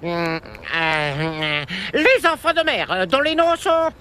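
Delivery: high, loud flustered babble; gush, then stumble searching; flustered excitement. Acted. A oublié les noms. (enthousiasme embarrassé)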